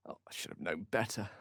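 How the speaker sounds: soft breathy self-reproach; whispered to himself. Acted. Wizard chiding himself after doubting her, whispered. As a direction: murmur it under your breath.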